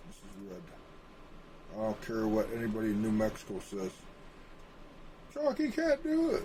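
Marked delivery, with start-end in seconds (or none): 5.3-6.4 high squeaky mock voice of a doubter; mocking naysayer. Ranting map-maker mocks a doubter in a high whiny voice. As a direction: sneer it up high like a whiny critic.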